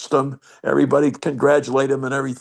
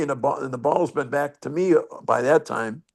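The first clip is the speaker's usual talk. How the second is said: ordinary delivery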